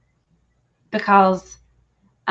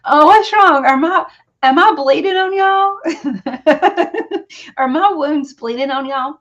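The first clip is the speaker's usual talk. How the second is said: high, loud, giggly joking; real laughter bubbling through the words; laughing through tears; 8 semitones higher, 4 dB louder than usual. Real, not acted. Teasing her viewers while still teary, in high, loud laughter.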